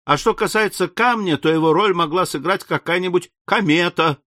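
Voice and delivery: lightly voiced breezy Ardan, undeterred. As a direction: press the theory on, undeterred. Voices confident Ardan in dialogue.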